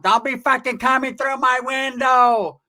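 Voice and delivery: high, drawn-out yell of an angry mother; slow, high-pitched mom impression; scolding mom. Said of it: "his mom".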